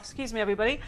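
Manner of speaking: ordinary talk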